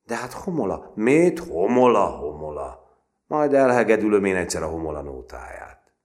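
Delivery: loud, high, scornful bluster; big boastful threat, thrown out loud; cursing bravado. A narrator's reading. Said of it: "jelentette ki fenéjázva".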